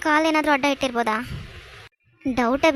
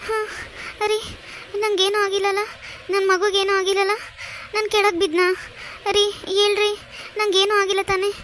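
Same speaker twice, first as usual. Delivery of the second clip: panicked pleading; 4 semitones higher, 4 dB quieter than usual. Acted. Pregnant wife panics after slipping.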